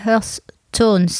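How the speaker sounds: "earth tones" said with a French accent; French-accented English. Real, not acted. Wedding planner naming a trend in French-accented English. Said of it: "Désolée pour mon accent pourri".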